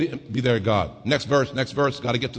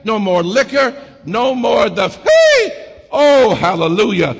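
much higher and louder, crying shout; preaching at full force; 7 semitones higher, 6 dB louder, slower than usual. Acted. Preacher shouting the promises over the organ, full-force whoop. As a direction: whoop it to the top.

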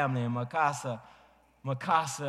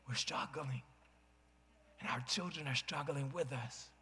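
much softer, near-spoken lament; hushed confession; 10 dB quieter than usual. Real, not acted. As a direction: drop the voice to a hush. Preacher drops voice.

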